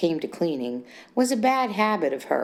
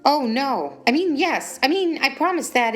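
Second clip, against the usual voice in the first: higher, louder flustered stammer; 8 semitones higher, 4 dB louder than usual. A narrator's reading. Wife panicking over the mess, flustered. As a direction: caught out, scrambling.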